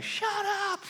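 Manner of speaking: high playful shout of shut up; mock shout. Preacher retelling yelling at his son in the woods, mock shout. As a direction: yell it at him half laughing. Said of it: "and I'm saying, shout".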